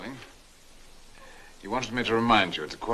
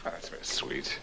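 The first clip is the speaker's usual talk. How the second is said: soft, patronizing murmur; 8 dB quieter than usual. Acted. Older man humouring a young admirer, softly patronizing. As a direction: humour them softly, as if patting a head. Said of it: "I'm sorry if I sounded patronizing".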